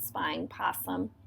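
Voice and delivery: ordinary delivery, nothing marked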